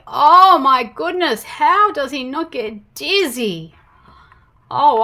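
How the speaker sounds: ordinary delivery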